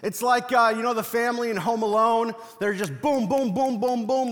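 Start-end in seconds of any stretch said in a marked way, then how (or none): none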